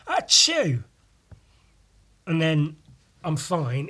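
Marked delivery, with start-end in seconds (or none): 0.1-0.8 a light mock sneeze pitched higher; fake sneeze. Shown as a demonstration. Vlogger acting out a single tidy sneeze, mock sneeze. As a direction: act out one neat little sneeze. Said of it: "It's never just".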